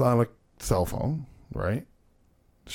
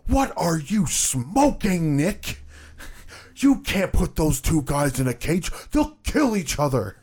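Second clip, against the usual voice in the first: incredulous high, loud outburst, words stretched out; Mean Gene; 9 semitones higher, 4 dB louder than usual. Retold or imitated. Wrestling announcer reacting to a cage match, high incredulous protest. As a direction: imitate an appalled announcer, pitched high and loud. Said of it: "Mean Gene's reaction to this is amazing".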